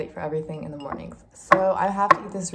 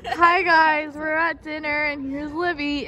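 bright vlog intro; 8 semitones higher, 5 dB louder, slower than usual. Shown as a demonstration.

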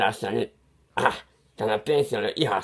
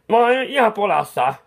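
alarmed cry; 9 semitones higher, 5 dB louder than usual. Acted. Painter in a fix.

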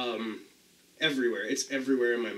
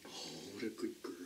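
very soft, tender praise; soft dog praise; 4 semitones lower, 20 dB quieter, faster than usual. Real, not acted.

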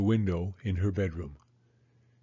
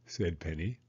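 ordinary delivery

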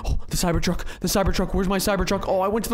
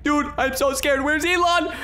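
ordinary talk

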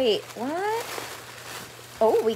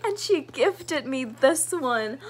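ordinary delivery

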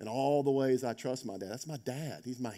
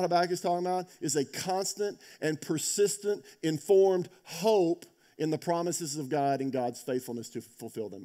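fervent preaching; 7 semitones higher, 5 dB louder than usual. Acted. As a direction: proclaim it with urgent conviction. Preaching the book's key statement.

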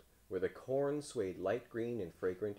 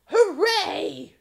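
very high, loud cheer; crow's shout; 16 dB louder than usual. A narrator's reading. Crow cheering that nobody wants corn, high shout. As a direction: squawk a joyful shout. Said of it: "shouted the cor-, crow".

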